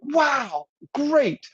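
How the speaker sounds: match their thrill right back; matching excitement. Shown as a demonstration. Listener matching a coworker's excitement, high and bright. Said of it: "in the tone of what they're saying".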